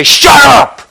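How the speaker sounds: high, sudden acted shout; exasperated outburst. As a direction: blurt it out as if fed up. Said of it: "I want to say".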